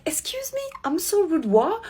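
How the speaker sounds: high, outraged "excuse me", then a breathy scoff; shocked she is the one called rude; indignant disbelief. Retold or imitated. Retelling her comeback to the pushy guy, outraged and high. Indignant retort to the man's insult.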